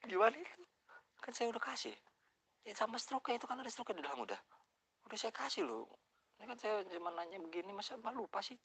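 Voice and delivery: high, heated insistence; angry complaint. Retold or imitated. Customer arguing with the cashier over the money, high and heated. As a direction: press her hard, pitched high. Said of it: "Gue langsung emosi".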